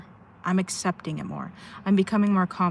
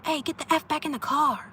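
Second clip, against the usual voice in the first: retold screaming; 9 semitones higher than usual. Imitating people yelling at a kid at the rest stop, mock scream. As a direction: imitate someone yelling, pitched well up. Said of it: "screaming, and they're like".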